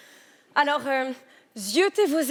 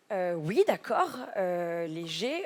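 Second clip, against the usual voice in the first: low, slow, unsure; sheepish hesitation; 6 semitones lower, 4 dB quieter, slower than usual. Acted. Answering the heckler, slow and sheepish. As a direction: drag it out, caught off guard.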